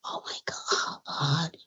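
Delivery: very hushed, low retold reaction; awed aside to self. Guest recalls marvelling to herself, hushed inner awe. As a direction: think it aloud, barely audible. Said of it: "I said to myself".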